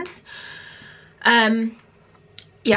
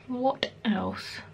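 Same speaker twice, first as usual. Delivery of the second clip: soft unvoiced whisper to herself; whispered aside; 2 semitones higher, 8 dB quieter than usual. Real, not acted. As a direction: breathe the question, thinking. Thinking aloud aside.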